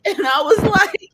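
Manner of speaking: ordinary delivery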